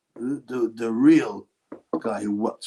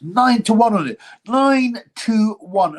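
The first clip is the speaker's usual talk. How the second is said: higher, louder, drawn-out repeat; crowing boast; 9 semitones higher, 5 dB louder, slower than usual. Real, not acted. Bettor bragging to his viewers about his winning tip, crowing. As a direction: crow the odds, stretching them out.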